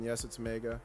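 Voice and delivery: ordinary talk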